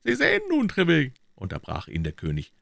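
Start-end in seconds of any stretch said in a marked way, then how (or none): 0.0-1.1 very high-pitched king's voice; interrupting king. Acted. King interrupting Treville's account, high insistent voice. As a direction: cut in with a high royal voice.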